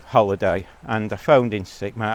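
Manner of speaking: normal talking voice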